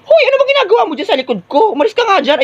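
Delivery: high, fast scolding; startled annoyance. Acted. Student shooing someone from behind, startled and annoyed. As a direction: shoo them away in a high, quick burst.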